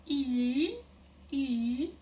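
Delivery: "E" sliding back towards ü; E with tongue pulled back. Shown as a demonstration. Teacher demoing E moving toward ü. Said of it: "moving your tongue a little backwards".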